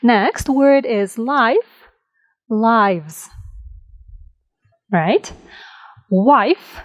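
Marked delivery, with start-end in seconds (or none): none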